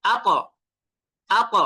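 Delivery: আক said as "at" with an echoing heavy t; pushed qalqalah. Shown as a demonstration. Teacher repeats the Ta example, final t in আক pushed out. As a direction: press tongue tip to palate and bounce the end of আক as t. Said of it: "এরকম".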